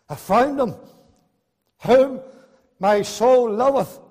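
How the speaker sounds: high and loud, slow on the last words; proclaimed. Acted. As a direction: lift the verse up with full voice. Verse cried out in preaching.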